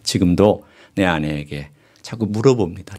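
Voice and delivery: normal talking voice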